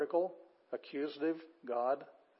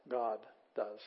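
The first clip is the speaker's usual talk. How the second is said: normal talking voice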